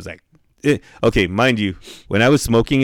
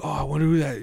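higher, softer mocking imitation; mocking jerk; 6 semitones higher, 5 dB quieter, faster than usual. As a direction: imitate someone being a smart-ass, pitched up. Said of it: "being an asshole".

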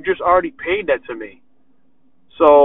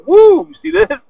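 loud, high-pitched showing-off; excited whoop; 14 semitones higher, 7 dB louder than usual. Acted. Barber showing off his fire cut to the shop, whooping excitedly. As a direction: whoop it out, proudly calling everyone to look. Acting out pride in fire cuts.

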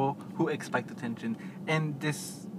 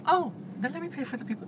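high, sugary do-gooder voice; mocking do-gooder; 11 semitones higher than usual. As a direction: parody a smug generous customer. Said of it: "It's not like".

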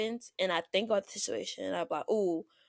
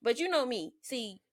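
ordinary delivery

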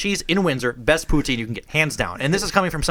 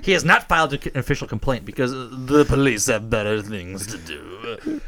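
put-on French accent; 6 semitones lower than usual. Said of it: "Is that your best French accent".

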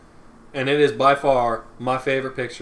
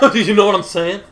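higher, louder mock catchphrase; imitating catchphrase; 7 semitones higher, 7 dB louder than usual. Mocking his friend's catchphrase after every joke, higher and louder. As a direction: parrot his friend's tic, a notch up. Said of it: "you go".

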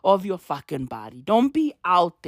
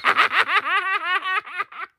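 high, shouted burst of laughter; cracks up, can't hold it in; 9 semitones higher than usual. Real, not acted. Laughs in disbelief.

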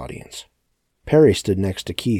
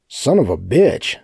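loud, raised, drawn-out curse; higher, louder and stretched; barked curse; 6 semitones higher, 7 dB louder, slower than usual. A narrator's reading. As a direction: bark it out in angry pain. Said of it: "Sebastian barked".

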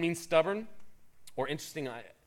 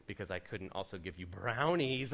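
soft, low tempter, lightly voiced; hushed voice of the ice cream; tempting ice cream voice; 5 semitones lower, 5 dB quieter than usual. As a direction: coax him like a sly temptation.

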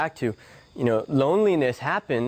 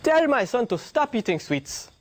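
high pleading mother's voice, pitched up; 9 semitones higher than usual. Retold or imitated. Mother begging the guru to stop her son, high pleading. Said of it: "and she says".

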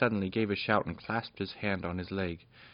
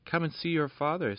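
joyful fairy queen; 8 semitones higher than usual. Acted. Lady Rosabelle weeping for joy.

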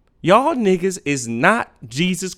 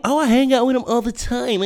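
higher, airy mock of a clueless friend; mocking naive friend; 6 semitones higher than usual. Mocking the friend who says they know people. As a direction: parody someone sure they know their friends.

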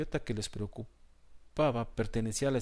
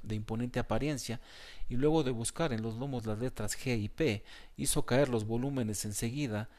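ordinary delivery, nothing marked

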